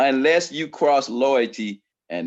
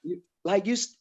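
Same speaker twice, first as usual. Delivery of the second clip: ordinary talk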